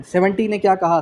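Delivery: ordinary talk, nothing marked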